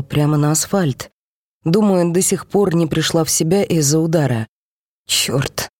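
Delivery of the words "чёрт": hissed under the breath. Acted. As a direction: hiss the curse. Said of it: "прошипела я".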